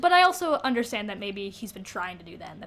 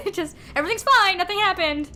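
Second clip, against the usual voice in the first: mock denial; 8 semitones higher, 7 dB louder than usual. Mocking Bella's denial.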